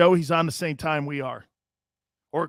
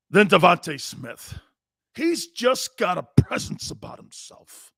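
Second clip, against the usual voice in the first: cheap impression; 6 semitones higher than usual. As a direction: draw it out in a cheap put-on voice. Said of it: "I'll do a cheap man's version".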